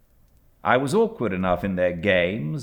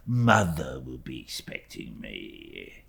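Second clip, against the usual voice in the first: low, slow, humble Uriah voice; lightly voiced humble clerk; Uriah Heep; 7 semitones lower, 4 dB quieter, slower than usual. A narrator's reading. Uriah speaking humbly to young David, low and slow.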